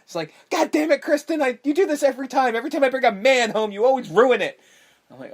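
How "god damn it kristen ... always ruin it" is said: very high, loud hammed-up tirade; high-pitched mock scolding yell; mock angry yelling. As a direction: imitate a mother shrieking at her kid. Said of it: "the fucking head starts yelling at Kristen".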